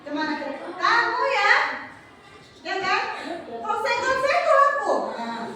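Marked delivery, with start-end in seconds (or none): 0.7-1.5 higher, sharper mock-angry voice; high-pitched angry demo. Shown as a demonstration. Teacher shows students how angry talk rises, higher pitch. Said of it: "berbicara dengan nada tinggi". 3.5-4.9 much higher mock-angry line, breaking into a laugh; half-laughing demo of shouting in a raised voice; high-pitched angry demo. Shown as a demonstration. Teacher acts angry talk for the class, laughing and high. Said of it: "berbicara dengan nada tinggi".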